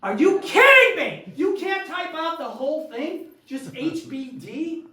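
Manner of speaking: high, loud, incredulous outburst; furious disbelief. Retold or imitated. Uncle ranting at his niece's lazy text, loud mock outrage. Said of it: "I was furious".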